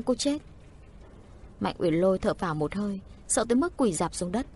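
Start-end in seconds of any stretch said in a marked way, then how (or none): none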